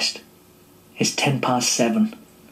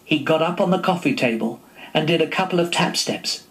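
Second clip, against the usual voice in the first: ordinary delivery, nothing marked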